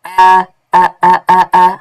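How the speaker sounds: loud held open-mouthed ah sounds; open-mouth vowel demo. Shown as a demonstration. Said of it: "See, you separate the mouth".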